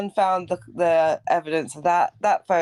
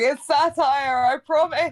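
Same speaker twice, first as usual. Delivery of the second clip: raised higher, slow and pleading; mock pleading voice; 6 semitones higher, slower than usual. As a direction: play a scared kid begging, drawn out. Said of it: "You'll be really young going".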